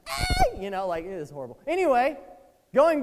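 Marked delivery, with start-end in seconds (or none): 0.1-0.6 put-on very high, yelped kid's greeting; mock your ten-year-old self on video; squeaky kid voice. Mocking his childhood voice in old videos, squeaky high yelp. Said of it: "My voice sounded like that?".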